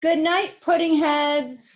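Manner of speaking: high, loud, slow calling mom; called out. Acted. Mom calling to the boys, high and loud. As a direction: call sweetly down the hall. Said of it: "called Froggy's mom".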